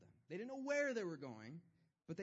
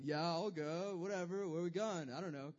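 louder, careless shrug of a voice; lazy bum Lot; 6 dB louder than usual. Retold or imitated. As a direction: sound like an uninterested slacker. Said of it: "He's like".

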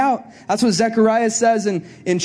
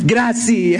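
playful fake Italian grazie; Italian thanks, hammed-up; mock Italian. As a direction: toss off grazie in a bad Italian accent. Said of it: "I don't speak Italian".

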